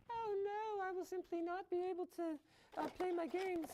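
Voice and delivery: high whiny fake wail; mock despair. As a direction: whine like a devastated gamer. Imagined gamer's dramatic complaint.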